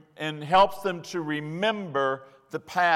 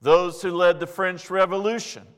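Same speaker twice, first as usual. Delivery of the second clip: rising preaching; 3 semitones higher, 4 dB louder than usual. Acted. Preaching at full force.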